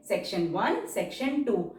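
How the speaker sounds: usual speaking voice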